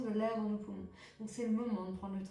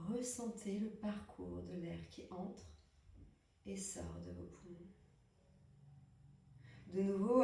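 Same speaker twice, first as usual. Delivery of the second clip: guided relaxation; 6 dB quieter than usual. Acted. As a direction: hush down and pace each breath cue. Leads breathing relaxation.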